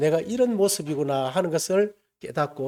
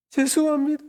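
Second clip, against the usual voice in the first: much higher, louder wailing 죄송합니다 as the sob breaks through; crying apology; 11 semitones higher, 4 dB louder than usual. Retold or imitated. Boy at camp apologizing to Jesus, breaking into sobs. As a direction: let the crying burst out on 죄송합니다. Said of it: "하면서 막 엉엉 우는 거예요".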